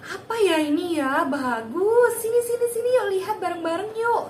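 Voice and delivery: put-on high, bright cheerful call to the child; playful, overjoyed mom voice; high excited voice. Shown as a demonstration. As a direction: sound thrilled to win his attention. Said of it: "menarik perhatiannya dia dengan intonasi".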